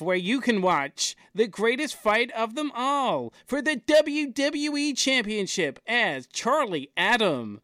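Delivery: big ring announcer voice; wrestling announcer. Pretend. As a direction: announce it like a title fight.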